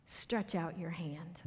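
soft unvoiced whisper. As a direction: breathe the words gently. Jesus speaks to withered man.

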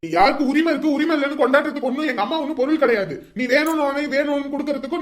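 loud furious tirade; angry shouting. Acted. Son raging at sister over mother, angry shouting. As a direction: rant at her without letting up.

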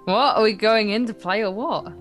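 ordinary talk

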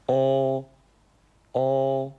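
"お" with lips tightly rounded, high, loud and level; rounded Mandarin o. Shown as a demonstration. Teacher modelling the second vowel, firmly rounded high "お". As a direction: purse the lips firmly and sustain "お". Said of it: "はっきり口を、".

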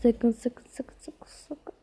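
whisper, trailing off to almost nothing; whispered pleading. Real, not acted. Tense editor begging the app not to fail, whispering. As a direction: hiss the warning under your breath until it vanishes.

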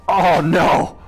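slow yelps of pain; real slowed shout of pain; pained outcry. Real, not acted. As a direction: cry out as the hit lands. Player character takes damage.

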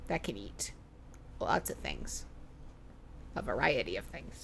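low, hushed innuendo; suggestive hush. Real, not acted. Joking about Vera's many mouths, in a sly hush. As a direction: drop to a sly near-whisper.

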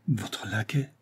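subdued, stunned question; stammering. A narrator's reading. Financier offered a lackey's place, stammering. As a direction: falter on the question. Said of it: "balbutia Saint-Renaud".

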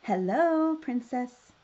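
loud, high, sing-out hello; storybook prince's big hello; delighted greeting. Acted. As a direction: greet her brightly as the freed prince.

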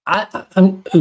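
too loud for the mic, then apologise; sudden loud outburst. Real, not acted. Podcast host, thrilled by the optic blast, shouts too loud. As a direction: burst out loud with excitement, then catch yourself. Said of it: "my, my level went out there".

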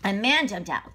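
plain reading voice

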